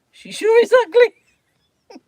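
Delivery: loud, high, drawn-out blurt; child's blurt. Retold or imitated. Youngest son to a couple about their daughter, blurting loudly. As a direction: blurt it out slowly at full volume. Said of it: "he said".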